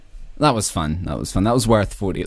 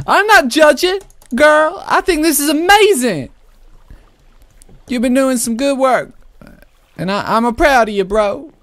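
high, loud sassy gal gushing over the build; sassy girl voice; 12 semitones higher, 4 dB louder than usual. Pretend. Praising a friend's house in a sassy high girl voice. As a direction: camp it up high and squealy.

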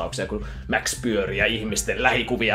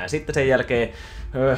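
normal talking voice

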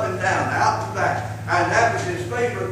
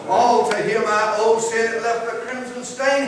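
preaching at full force; 4 semitones higher, slower than usual. Acted. Preacher to congregation, rising to full force. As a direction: build it up like a revival sermon, slowing on the last words. Preacher chants hymn words.

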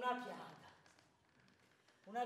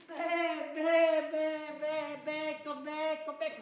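loud jeering chant; mocking street kids; 3 semitones higher, 7 dB louder, much slower than usual. Village kids jeering at the cuckold, chanting. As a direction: taunt like a pack of kids. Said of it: "a gridarmi".

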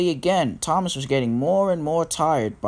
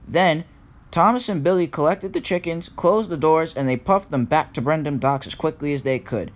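plain reading voice

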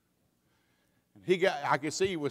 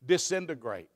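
ordinary talk, nothing marked

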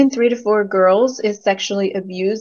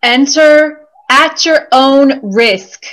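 slow, loud, higher-pitched warning; ominous warning; 4 semitones higher, 5 dB louder, slower than usual. Acted. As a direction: proclaim it slowly like a danger notice. Acted warning in staged answers.

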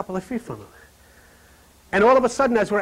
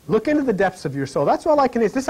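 normal talking voice

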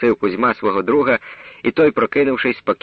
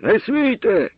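sharp high cry to stop them; high shout; 11 semitones higher, slower than usual. A narrator's reading. Metelyk shouting to stop the crowd beating the boy. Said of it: "закричав Метелик".

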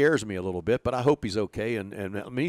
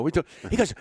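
ordinary delivery

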